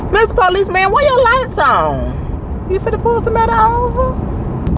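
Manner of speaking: sing-song high question to the police; playful high-pitched ribbing of a passing cop; mock high teasing call. Talking to a police car while driving.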